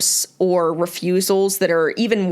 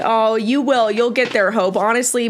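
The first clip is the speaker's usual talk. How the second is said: ordinary talk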